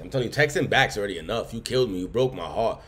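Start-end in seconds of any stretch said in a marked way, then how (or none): none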